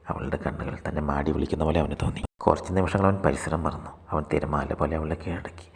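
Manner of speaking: ordinary delivery, nothing marked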